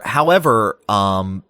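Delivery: ordinary talk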